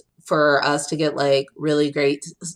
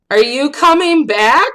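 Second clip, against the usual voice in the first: higher, louder call down the stairs; kid calling down; 9 semitones higher, 6 dB louder than usual. Retold or imitated. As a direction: call down to the basement. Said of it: "one of the kids were like".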